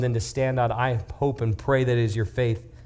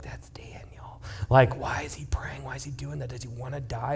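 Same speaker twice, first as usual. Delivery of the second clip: hushed gossiping whisper; 8 dB quieter than usual. Acted. Onlookers whispering about Daniel praying, hushed. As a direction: whisper it like nosy neighbours. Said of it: "everybody's like".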